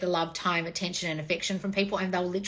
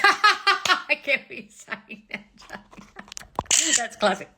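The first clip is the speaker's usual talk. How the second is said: laughing; 5 semitones higher, much slower than usual. Real, not acted. Laughing at garbled comment.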